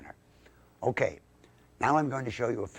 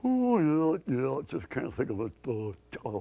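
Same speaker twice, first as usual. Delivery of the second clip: lightly voiced befuddled dope; 3 semitones higher than usual. Dim dummy can't think of it, lightly befuddled voice. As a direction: fumble for it, dim and hesitant. Slow-witted Mortimer Snerd character.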